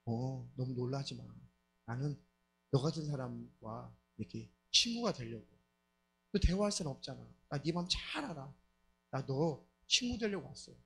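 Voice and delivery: calm her down and reel her in; soothing demon. Retold or imitated. Demon befriending a lonely wife, low and soft.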